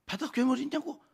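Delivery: high-pitched incredulous challenge; acted-out affronted retort; defiant protest. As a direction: throw the question back indignantly. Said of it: "하나님한테 이제 막 대드는 거예요".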